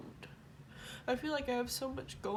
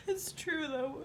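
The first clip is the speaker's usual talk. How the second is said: crying; 7 semitones higher, slower than usual. Real, not acted. Crying while confessing.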